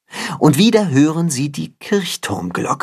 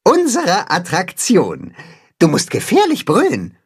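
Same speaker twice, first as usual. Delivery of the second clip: eager instruction; 7 semitones higher than usual. Acted. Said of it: "grinst das Katzenhuhn".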